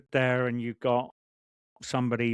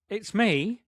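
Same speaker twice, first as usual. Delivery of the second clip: retold reply; 8 semitones higher than usual. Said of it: "I was like".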